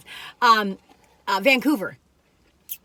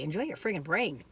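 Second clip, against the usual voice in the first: dry comeback; 4 semitones lower, 9 dB quieter than usual. Retold or imitated. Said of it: "I say".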